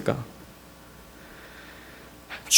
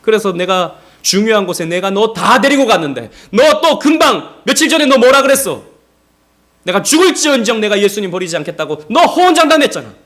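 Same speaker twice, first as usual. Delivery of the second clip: raised, indignant telling-off; mock reproach; 5 semitones higher, 5 dB louder than usual. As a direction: pile up accusations, acting out a scolding. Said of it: "라고 말씀하지 않으시고".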